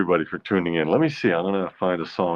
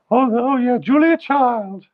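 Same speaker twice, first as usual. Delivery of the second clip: put-on high falsetto Julia Child voice; Julia Child impression; 5 dB louder than usual. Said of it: "a bit of a Julia Child".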